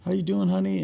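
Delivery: softly imitating a wife delighted to see her husband; sweet wife greeting. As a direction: greet him like a happy, adoring wife. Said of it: "she says".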